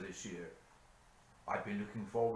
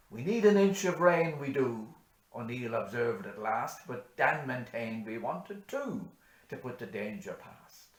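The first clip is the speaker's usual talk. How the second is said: loud, high, ringing recital; bush poem recitation; 6 semitones higher, 9 dB louder, slower than usual. Acted. Reciting Says Hanrahan for parishioners, loud declaiming. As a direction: declaim the ballad to the whole parish.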